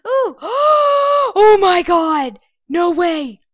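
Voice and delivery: loud, high thrilled gasp and cry; excited gasp. Real, not acted. Gamer recognizing the map, loud excited gasp. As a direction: blurt out delighted disbelief.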